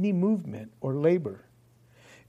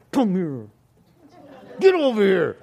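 sharp, demanding order, rising louder; loud, higher "get over here"; harsh summons; 4 semitones higher, 4 dB louder than usual. Shown as a demonstration. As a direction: bark the order like an impatient master. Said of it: "He doesn't, like".

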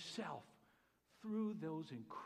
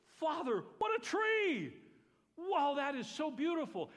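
much higher and louder, bursting with delight; excited praise; 11 semitones higher, 10 dB louder, slower than usual. Shown as a demonstration. A believer praising God for a tree: thrilled, much higher and louder. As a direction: shout the joy at the tree. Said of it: "I can say".

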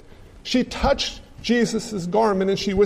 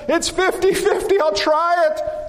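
unsure hunter; 8 semitones higher than usual. Acted. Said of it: "The second man says".

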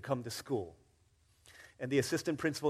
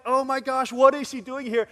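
put-on amazed kids; 10 semitones higher, 10 dB louder than usual. Kids amazed at surprise visitor.

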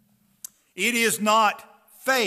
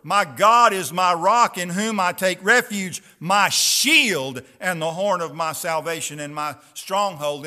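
louder, ringing scripture proclamation; preaching; 5 dB louder than usual. Acted. Preacher proclaiming psalms to the congregation, ringing out. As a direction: proclaim it to the whole hall.

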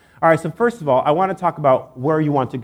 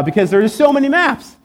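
comic exasperation; 10 semitones higher, 4 dB louder than usual. Retold or imitated. Comic exasperation over endless maps.

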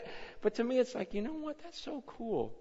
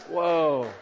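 amazed whoa; 2 semitones higher, 8 dB louder than usual. Retold or imitated. Reacting to answered prayer.

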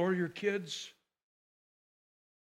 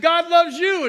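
much higher and louder, God loves you driven home; emphatic declaration; 8 semitones higher, 10 dB louder than usual. Retold or imitated. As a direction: insist it to win him over. Pastor insisting to a doubting man.

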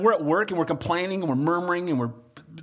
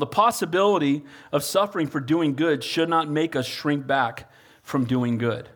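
ordinary delivery

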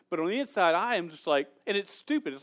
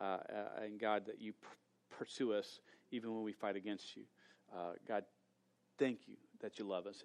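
low, hushed prayer voice; quiet prayer; 7 semitones lower, 9 dB quieter than usual. Real, not acted. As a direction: pray softly and low before the congregation. Pastor leads a prayer.

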